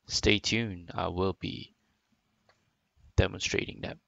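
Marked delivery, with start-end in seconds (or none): none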